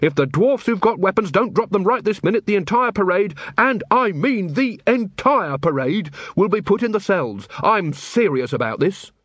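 stern, high-pitched ultimatum; angry order. Acted. Carrot ordering dwarfs to disarm.

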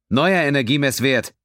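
high, loud bridge officer's alert; calling out. A narrator's reading. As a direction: call out the new reading urgently. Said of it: "rief Xev aus".

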